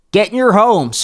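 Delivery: high, loud commanding shout; screamed order. Acted. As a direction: order the villagers inside. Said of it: "Father Tuft screamed at the".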